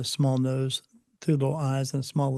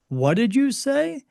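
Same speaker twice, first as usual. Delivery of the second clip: high, slow, incredulous; can't believe what he just heard; high, drawn-out shocked question; stunned disbelief; 10 semitones higher, slower than usual. Retold or imitated. Shocked at God's crude joke.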